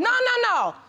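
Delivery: high, insistent cut-off; urgent overriding objection; firm interruption. Real, not acted. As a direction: shut the answer down with rapid repeats. Judge cuts off witness's answer.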